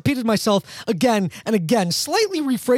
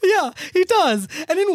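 laughing; 10 semitones higher than usual. Real, not acted. Cracking up at the hat joke.

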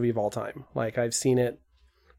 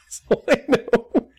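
high, slow, laughing voice; laughing admission; 9 semitones higher, slower than usual. Real, not acted. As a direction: draw it out high through laughter. Laughing admission after the running gag.

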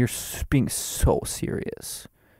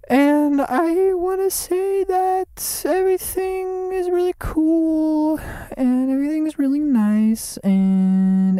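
mock soothing falsetto; 3 dB louder than usual. Sudden sing-song reassurance bit.

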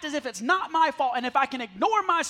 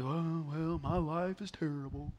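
low, softer mopey Eeyore moan; 7 semitones lower, 8 dB quieter than usual. Retold or imitated. Pastor mocking gloomy Christians, lower, softer Eeyore moan. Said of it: "Y'all are like".

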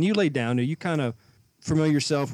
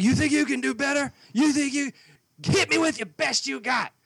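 taunting boater; 11 semitones higher than usual. Retold or imitated. Dockhand mimics the angry boater daring him, high mocking taunt. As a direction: throw the challenge up high like a sneering tough guy.

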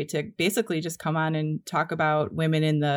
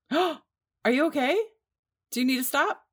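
worried gasp; 7 semitones higher than usual. Real, not acted. Friend stepped on glass.

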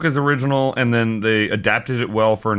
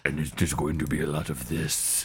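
very low, hushed impression voice; deep, quiet movie-villain impersonation; Tony Todd; 10 semitones lower, 11 dB quieter than usual. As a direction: drop right down deep and soft. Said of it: "fairly decent Tony Todd impression".

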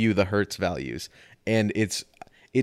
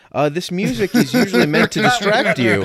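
loud over the chatter; 9 semitones higher, 8 dB louder than usual. Real, not acted. Restarts over others, loudly.

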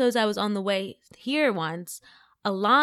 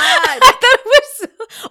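high, loud, slow words through laughter; laughing; 12 dB louder, slower than usual. Real, not acted. Fan recalling a favorite Office episode, laughing hard. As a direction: crack up while getting the words out.

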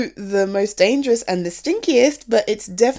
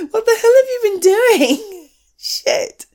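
laughing disbelief; 10 semitones higher, 5 dB louder than usual. Acted. Finds partner burned dinner.

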